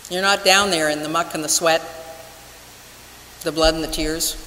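ordinary talk

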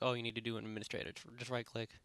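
ordinary talk, nothing marked

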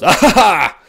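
loud, high "ah ha ha" yell; mock outburst that would overload the mic; sudden loud outburst. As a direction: blurt a mic-distorting cackle. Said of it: "will probably distort if the person".

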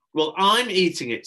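put-on high, loud boyish defiance; defiant. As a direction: declare it stubbornly to an adult. Boy defies the adult.

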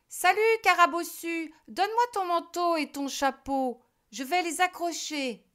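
high, loud cheeky crow voice; crow's voice. A narrator's reading. Crow greeting the witch, high and cheeky. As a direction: play the crow as a bright busybody. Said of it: "Il dit".

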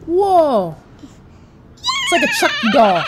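high shocked squeal. Pretend. Shocked at live toy.

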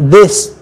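"this" with a buzzing TH; voiced TH. Shown as a demonstration. Teacher giving a vibrating example, voiced TH. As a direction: vibrate the start of "this". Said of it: "các bạn rung lên".

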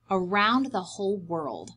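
ordinary delivery

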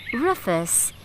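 ordinary talk, nothing marked

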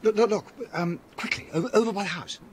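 high, quick, urgent pointing out; urgent alarm. Acted. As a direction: call his friend's attention in alarm. Spots a figure in dark.

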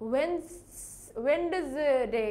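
drawn-out, slow ওয়েডনেসডে, "wen-duz-day"; pronunciation to avoid. Shown as a demonstration. Teacher showing what not to say, laboured extra-syllable mispronunciation. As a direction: hold the S, then add an extra D-Z syllable in ওয়েডনেসডে. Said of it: "এরকম অন্য কিছু আমরা বলবো না".

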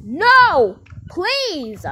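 high, loud pretend begging cry; desperate pleading. Kidnapper being taken to police station, acted desperate plea. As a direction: cry out and beg, pitched high.

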